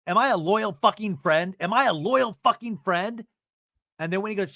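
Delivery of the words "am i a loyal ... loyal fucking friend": loud, high, insistent retold demand; acted indignation. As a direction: hammer the question out, then repeat it. Acted quarrel, demanding loyalty.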